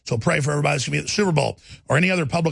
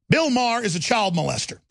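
usual speaking voice

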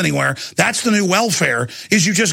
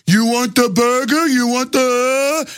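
higher, slow, dim-witted mumbling jailer; Quasimodo-like jail guard; 5 semitones higher, slower than usual. Retold or imitated. Jail guard taunting hungry prisoners, slow hunchback voice. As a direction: drag it out like a lumbering movie hunchback. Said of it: "like Quasimodo".

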